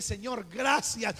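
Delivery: strong slow "Señor, gracias"; confident thanks. Retold or imitated. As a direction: declare thanks boldly, sure of the lesson. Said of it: "yo entendí y dije".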